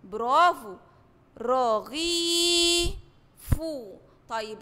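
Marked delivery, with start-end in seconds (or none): 1.4-2.9 رغيف with the ee held long and slow; drawn-out long vowel. Shown as a demonstration. Teacher drawing out the vowel for first graders, slow. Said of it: "لو معاه مد بالياء هيبقى زي كلمة".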